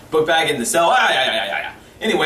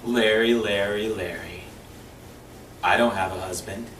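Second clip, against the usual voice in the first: sultry seductress; 6 semitones lower, 6 dB quieter than usual. Retold or imitated. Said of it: "she walks closer to him and says".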